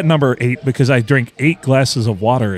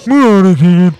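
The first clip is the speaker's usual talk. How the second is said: higher, much louder mock cashier voice; 6 semitones higher, 8 dB louder than usual. Cohost echoes the cashier, in a loud, high mocking voice. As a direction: throw the line back like the dopey worker.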